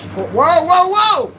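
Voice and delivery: high, loud startled cry; startled whoa. Real, not acted. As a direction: yelp it out as the cat jumps. Startled by the cat.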